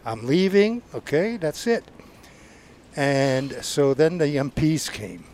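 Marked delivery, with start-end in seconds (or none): 0.0-1.8 defiant. Retold or imitated. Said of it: "You can't leave".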